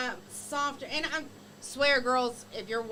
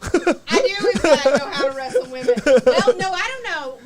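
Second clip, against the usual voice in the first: loud flustered answer; 4 semitones higher, 15 dB louder than usual. Real, not acted. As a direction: blurt it out loud, laughing. Flustered, laughing at the question.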